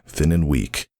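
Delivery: plain reading voice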